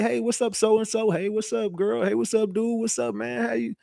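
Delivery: high, light and soft fake buddy voice; mock friendly greeting. Mocking the feds who greet everyone in his chat, fake-friendly.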